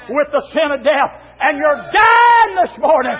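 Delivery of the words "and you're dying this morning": high, drawn-out preaching shouts; full-force preaching. Real, not acted. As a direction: cry it out to the sinners.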